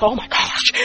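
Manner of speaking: breathed out in a voiceless whisper; stunned gasp. Retold or imitated. Preacher realizing she is late after the miracle, stunned. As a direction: gasp it under your breath.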